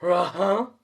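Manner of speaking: Scooby voice. A narrator's reading. Scooby answers Shaggy.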